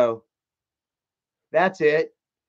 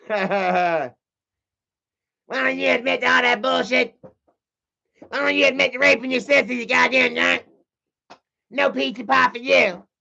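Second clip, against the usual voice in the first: high whiny cartoon kid; bratty cartoon brat scolding; Cartman voice; 8 semitones higher than usual. Mocking his brother in a high bratty cartoon voice. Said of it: "He talks like Cartman".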